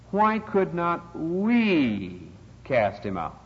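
WE stretched out long and high; slow question with a long, emphatic WE; stress on WE. Retold or imitated. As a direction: draw out the word WE slowly before finishing. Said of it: "how emphatic the words are".

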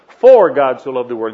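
"For" leaned on loudly, the rest falling away; stress on For. Shown as a demonstration. Preacher returning to the connective word, "For" stressed. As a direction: land hard on "For" and let the line settle. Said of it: "Then you go to the next word".

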